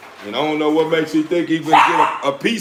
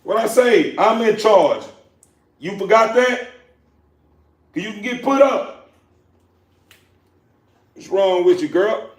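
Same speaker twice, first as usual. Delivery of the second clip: raised, high, firm scolding; hollering at puppy; 8 semitones higher, 3 dB louder than usual. Real, not acted. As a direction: put bass in it and lay down the law. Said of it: "That's how you do it".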